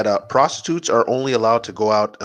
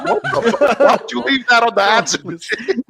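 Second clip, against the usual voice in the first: mock disbelief; 11 semitones higher than usual. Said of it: "I'm like".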